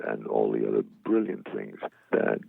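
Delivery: ordinary talk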